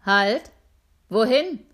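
loud halt call from the gate; shouting guard. Acted. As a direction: bark a stop at the gate. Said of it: "rief der Wächter am Tor".

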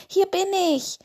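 high calling cry. A narrator's reading. Lars calls to his father from the whale, loudly. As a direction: call across the ice. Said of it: "rief er laut".

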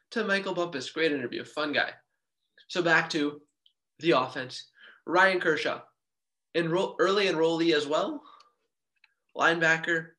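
normal talking voice

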